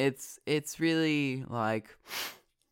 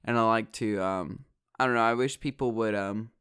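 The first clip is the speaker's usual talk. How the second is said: ordinary talk, nothing marked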